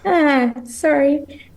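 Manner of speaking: laugh-cry apology, slowed on the sorry; tearing up. Real, not acted. Guest apologizing to the host for getting emotional, tearful laugh. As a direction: voice lifting high and unsteady as she tears up, laughing through it. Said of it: "I am so sorry that I'm getting emotional".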